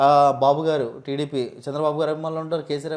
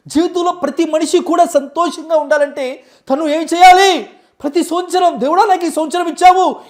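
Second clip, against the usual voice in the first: much higher, loud preaching voice; excited guru impression; 11 semitones higher, 9 dB louder than usual. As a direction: proclaim the new year prayer with zeal. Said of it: "డివోషనల్గా కొంతమందిని తీసుకొద్దాం".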